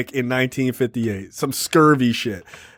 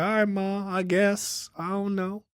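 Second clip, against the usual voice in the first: put-on small, softer and higher boy's voice; reluctant son; 5 semitones higher, 8 dB quieter, slower than usual. Son half-accepts Ma's new man, soft and hesitant. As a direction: give in softly, imitating an unsure kid.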